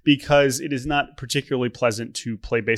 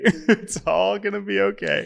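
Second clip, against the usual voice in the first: laughing; 7 semitones higher, slower than usual. Real, not acted.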